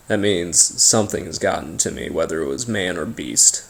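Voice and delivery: ordinary talk, nothing marked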